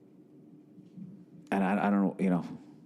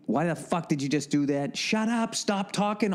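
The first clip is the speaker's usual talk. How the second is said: mock yelling at characters on screen, higher; 4 semitones higher than usual. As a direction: snap at the screen like a fed-up viewer. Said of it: "I get so fucking mad".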